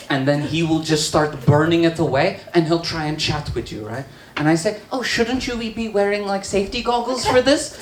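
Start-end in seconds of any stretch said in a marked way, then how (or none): 4.9-7.8 high, tentative polite question; tentative concern. Retold or imitated. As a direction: query it timidly in a raised voice. Acts own worried question.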